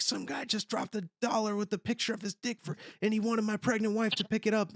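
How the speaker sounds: high, strained outraged rant; re-enacted yelling. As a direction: rant it high-pitched at a stranger. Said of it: "the way I yelled it".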